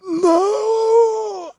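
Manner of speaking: long, very high, loud howl; horrified wail. A narrator's reading. Carstairs crumples before his acolytes, horrified wail. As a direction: wail in utter despair.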